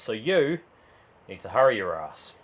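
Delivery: plain reading voice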